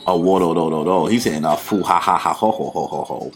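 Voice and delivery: mock drunken villain laugh; lightly hammed drunken cackle. Shown as a demonstration. Reader imitating Kaido's laugh, mock drunken. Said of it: "Kaido laughing".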